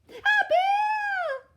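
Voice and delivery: very high, very loud shriek; terrified shriek. Acted. Mum finally seeing the bear, very high loud shriek. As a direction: shriek in terror.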